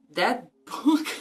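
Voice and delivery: laughing. Real, not acted. Laugh while reading.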